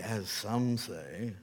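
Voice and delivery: slow, drawn-out sneer at the critics; slowly mocking the accusers; sarcastic. Shown as a demonstration. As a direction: let the irony hang on each word. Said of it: "a skosh of sarcasm".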